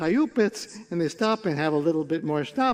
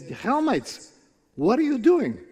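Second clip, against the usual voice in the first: high-pitched astonished challenge; incredulous calling out; 6 semitones higher, slower than usual. Acted. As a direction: call out to fools in disbelief. Visitor calls out to the villagers.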